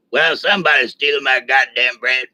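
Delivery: lightly voiced gruff sergeant; imitating the Sarge. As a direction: grumble it like the old Sarge. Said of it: "He talked like that for real".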